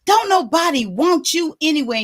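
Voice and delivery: retold scolding that keeps on going; fussing. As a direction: imitate a mother tearing into her kid. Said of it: "she's just going and going".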